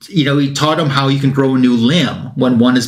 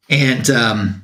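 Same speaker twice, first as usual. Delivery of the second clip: ordinary delivery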